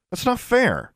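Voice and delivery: put-on high-pitched girlish complaint; outraged young girl; indignant protest. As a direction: protest it, voice leaping up then dropping. Girl protests sphinx's trick.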